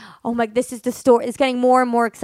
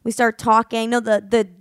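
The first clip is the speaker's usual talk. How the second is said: usual speaking voice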